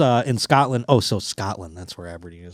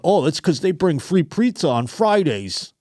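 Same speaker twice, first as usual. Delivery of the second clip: higher, chirpy imitation of an easily pleased worker; mock naive office guy; 7 semitones higher than usual. Underpaid office worker excusing his wage, mock cheerful. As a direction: play up the pizza excitement, mockingly.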